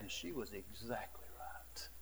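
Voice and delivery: hushed, humbled whisper; whispered admission. Real, not acted. Pastor admitting the girl was right, whispered. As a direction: let it drop to a whisper.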